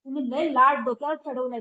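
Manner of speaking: loud retold reproach; retold scolding. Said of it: "मला ओरडतात".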